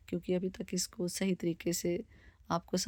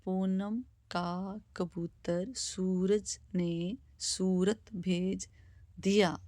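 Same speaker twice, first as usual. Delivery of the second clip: slow example sentence; much slower than usual. Shown as a demonstration. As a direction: read it slowly like a teacher. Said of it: "और एक वाक्य देख लेते हैं".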